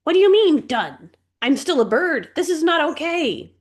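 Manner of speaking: high, loud, frantic screech; screeching bird. A narrator's reading. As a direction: shriek it at her in outrage. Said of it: "Zoe screeched".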